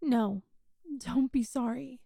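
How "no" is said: ordinary delivery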